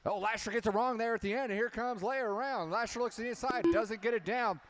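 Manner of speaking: high, fast alarmed race call; excited crash call. Real, not acted. Crash at the finish line.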